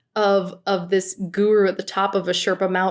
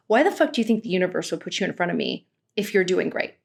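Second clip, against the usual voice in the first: fast, dismissive rant; rapid mocking quote; 2 semitones lower, much faster than usual. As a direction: rattle it off like she's scolding him. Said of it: "And she goes".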